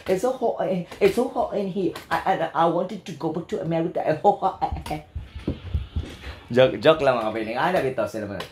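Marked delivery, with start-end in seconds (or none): 0.1-4.0 higher-pitched fake British; mock British accent. Host joking as a British speaker, mock British accent. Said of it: "mga British".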